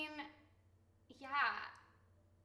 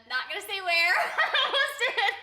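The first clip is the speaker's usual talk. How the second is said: louder, higher, breaking into laughter; laughing; 6 semitones higher, 10 dB louder than usual. Real, not acted. Streamer nearly reveals her location to viewers, laughing. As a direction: catch yourself and crack up.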